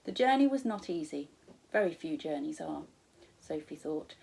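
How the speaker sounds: ordinary delivery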